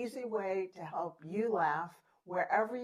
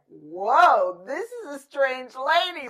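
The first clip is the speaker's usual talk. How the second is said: loud startled "Whoa", then higher; mock neighbor; 6 semitones higher, 14 dB louder, slower than usual. Host imitating her neighbor, loud startled mock. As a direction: play the baffled neighbor, loud and high. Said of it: "neighbor would be like".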